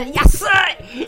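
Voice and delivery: loud, high Japanese shout of delight; cheerful yell. Retold or imitated. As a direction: shout it like the excited brothers. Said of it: "两个人在那边喊".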